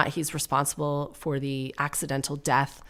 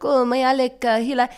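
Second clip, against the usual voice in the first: high, put-on Spanish accent; mock Spanish accent, groping for words; Hilaria; 8 semitones higher, 4 dB louder than usual. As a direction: imitate a fake Spanish accent, pitched up. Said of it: "quite an offensive accent if you're just putting it on".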